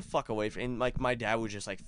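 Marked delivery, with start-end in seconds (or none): none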